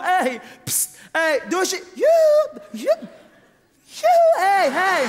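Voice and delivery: climbing yelps, very high and loud; catcall shout. Shown as a demonstration. Comedian shows women how to catcall men, loud squealing yells. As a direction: yell joep joep up into a squeal. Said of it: "roep gewoon".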